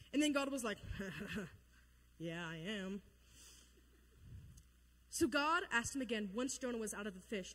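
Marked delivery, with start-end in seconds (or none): 0.8-1.6 low, soft put-on chuckle; short self-satisfied acted laugh; God's mock chuckle. God's amused reply to Jonah. 2.2-3.0 low, soft self-assured reply; understated smug God, dropped in pitch; lightly voiced God. God smugly accepting the praise.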